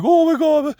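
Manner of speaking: high, loud hammed-up cry; exaggerated squeal of alarm; mock freak-out. Walker reacting to someone's loose dog, high mock freak-out.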